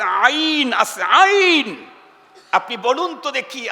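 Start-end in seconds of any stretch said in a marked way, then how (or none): 0.0-1.9 stretched throaty আইন; Arabic letter demo. Shown as a demonstration. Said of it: "আরবিতে হামজা আছে".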